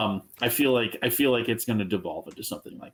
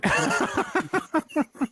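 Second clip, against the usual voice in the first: high laugh. Real, not acted. Laughs at forgotten name.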